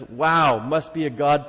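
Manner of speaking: big retold amazement, easing off; amazed read-out. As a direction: read the reply out in acted wonder. Reads out the amazing text reply.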